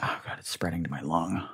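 mock stricken whisper. Host pretends a virus is spreading, mock stricken whisper. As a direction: whisper it like a doomed patient. Playing at sudden infection.

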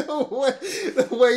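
high, loud words through the laugh; laughing while talking. Real, not acted. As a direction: talk while still cracking up.

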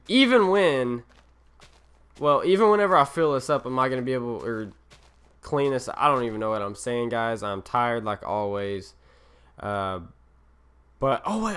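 ordinary talk

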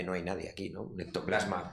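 a hint of pretending nobody is there; muttered dismissal. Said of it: "En plan de".

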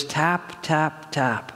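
hushed, separated little taps; soft tapping. Retold or imitated. Sensing a nudge in the hotel line, softly. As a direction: let each word land softly, like a light tap. Imitating a gentle prompting.

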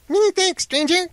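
very high, small exclaiming voice; crackly fire man. A narrator's reading. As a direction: thank the rescuer as a little flame come to life. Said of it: "he cried, snapping his fingers".

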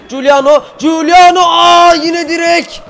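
very high, very loud, drawn-out cry of frustration; real anguished screaming. Real, not acted. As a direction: scream the name, then wail that it's enough. Hits post again, he erupts.

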